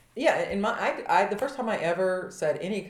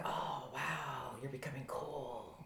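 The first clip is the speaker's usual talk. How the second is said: low, soft, underwhelmed wow; mock-impressed, dropping low and quiet; mocking peers; 4 semitones lower, 12 dB quieter, slower than usual. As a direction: imitate kids quietly teasing, pitched down. Kids mocking her first swearing.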